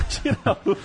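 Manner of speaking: shouted through a laugh. Retold or imitated. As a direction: yell it while laughing. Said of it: "gritando assim pro goleiro".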